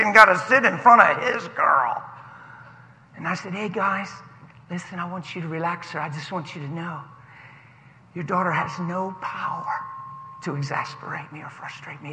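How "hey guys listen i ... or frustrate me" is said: soft, low-key soothing voice; calm reassurance. Retold or imitated. Passenger reassuring girl's dad on the plane, soft and calm. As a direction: gently put a stressed parent at ease.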